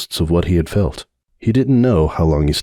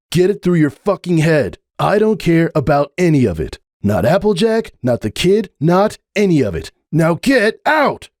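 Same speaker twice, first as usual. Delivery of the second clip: higher, building to a shout; screaming; 9 semitones higher than usual. Acted. Hoops screaming at Sunburst to leave. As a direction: rage at him to get out. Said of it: "He screamed the last words so loud".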